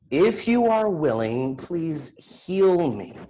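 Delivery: pleading. Acted. Sick man begging Jesus to heal him, pleading. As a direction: beg for healing, voice lifting. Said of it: "he pleaded".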